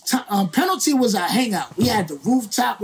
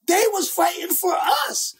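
fired up; 9 semitones higher than usual. Real, not acted. Emotional emphasis telling story.

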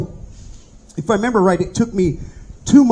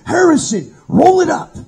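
higher, louder barked order; cop order; 9 semitones higher, 4 dB louder than usual. Retold or imitated. Cops tell the inmate to pack up, barked order. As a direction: call him out of the cell with authority.

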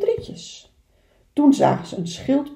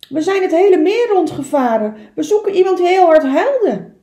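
put-on high, loud and quick, an eager young beaver; little beaver voice; 8 semitones higher, 9 dB louder than usual. Little beaver telling the wise beaver, eager and high. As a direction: chatter like an excited little beaver. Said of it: "zei Kleine Bever".

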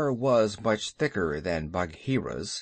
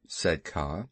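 ordinary delivery

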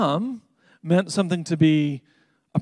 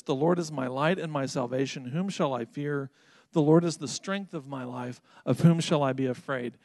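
softer, reflective reread of the verse; scripture reread; 2 semitones lower, 4 dB quieter than usual. Acted. Pastor revisiting the first verse for his listeners, softer and reflective. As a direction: linger over the verse again, a touch softer.

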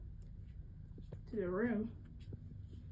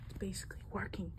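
whispered aside; whispering; 4 semitones lower, 13 dB quieter, faster than usual. Acted. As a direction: whisper it to yourself. Aside while tricking.

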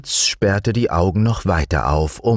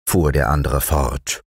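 plain reading voice

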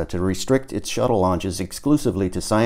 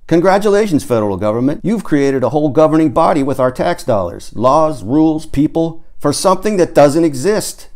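loud, raised sarcastic tirade; mocking rant; 6 semitones higher, 5 dB louder than usual. A narrator's reading. Tom mocking the federal government to his rocket friends, loud sarcasm. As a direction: hurl sarcastic congratulations at the government.

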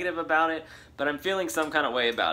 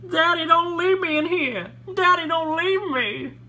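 put-on child crying; 7 dB louder than usual. Kale child cries.

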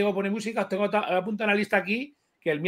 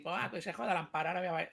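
much softer, tossed-off mock spite; mock spiteful aside; 13 dB quieter, faster than usual. To the panel, mocking his supposed spite, softly. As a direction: throw it away like a spiteful quip. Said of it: "no lo hago con rintintín de decir".

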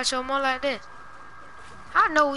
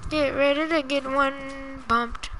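1 slow taunt; 4 dB quieter, slower than usual. Real, not acted.

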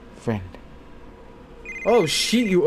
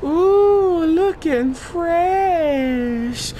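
high, very drawn-out crooning admiration; admiring whoop; 11 semitones higher, much slower than usual. Real, not acted. Game player admiring his character, high and drawn-out. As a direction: stretch it out high, showing off.

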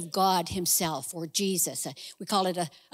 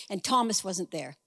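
ordinary talk

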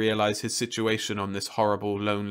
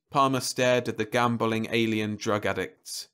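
ordinary delivery, nothing marked

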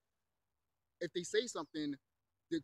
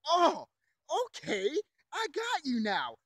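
loud, high "oh", then slower; mock realization; 8 semitones higher, 6 dB louder, slower than usual. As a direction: act out an indignant moment of catching on. Acting own reaction to their reply.

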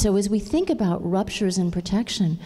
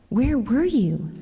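plaintive question; 6 semitones higher, slower than usual. Acted. Hurt child rabbit asks.

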